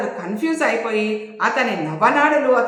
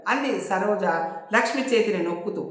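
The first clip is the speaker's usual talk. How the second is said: plain reading voice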